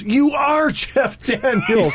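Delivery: mock amazed cry, high and drawn out; astonished fan. Camper recognizing the actor he was chatting with, mock astonishment.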